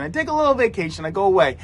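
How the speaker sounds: usual speaking voice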